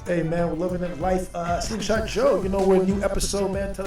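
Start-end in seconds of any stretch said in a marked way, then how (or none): none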